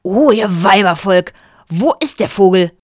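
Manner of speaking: pompous husband. A narrator's reading. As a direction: sweep in like an opera hero. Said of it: "erwiderte er". (laut und großspurig)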